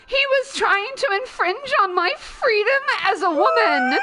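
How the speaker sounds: hammed-up outraged princess; mock Jasmine whine. Jasmine complaining to Jafar about the suitor, mock indignant. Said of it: "she's like".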